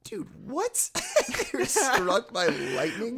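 high, loud, laughing disbelief; can't believe what she just read; stunned disbelief. Real, not acted. Cohost reacting to cameras struck by lightning, laughing in disbelief.

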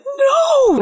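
a sudden, very high mock shriek of no; shrieked kid's no. Parent imitating a defiant kid: high mock shriek. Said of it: "your kid's like".

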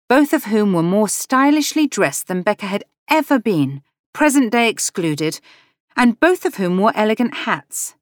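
plain reading voice

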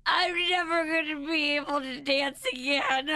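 high-pitched mock wail; imitated crying. Mom mimicking daughter after the crash, wailing. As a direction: wail it like a melodramatic little girl. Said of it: "she just starts crying".

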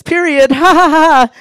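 high, loud cackle at his own pun; laughing dad. Retold or imitated. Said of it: "he goes".

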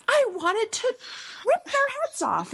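very high, hammed-up rage; comic outrage, squeaking up high; mock fury. Grieving mother joking she'd rip heads off, high mock fury. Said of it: "She's like".

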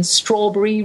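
strawberry with the berry clipped to brie; strawberry, British style, ending bree. Shown as a demonstration. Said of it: "Words ending in berry are pronounced brie".

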